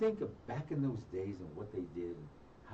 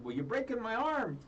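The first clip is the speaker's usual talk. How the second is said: pained victim voice; 13 semitones higher, 4 dB louder than usual. Retold or imitated. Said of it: "they're already breaking his arm".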